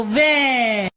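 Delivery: वै held long, gliding down; drawn-out syllable. Shown as a demonstration. Teacher pulling the sound out of the word, drawn-out वै. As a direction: isolate and stretch वै. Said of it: "हम बोलते हैं ना".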